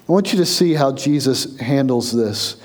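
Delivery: ordinary talk, nothing marked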